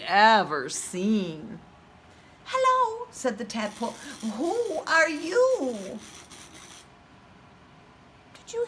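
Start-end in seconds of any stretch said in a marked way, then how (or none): 2.4-3.1 very high, tiny tadpole voice. Acted. Tadpole greets rabbit. 4.4-6.0 very high, tiny tadpole voice. Acted. Tadpole asks.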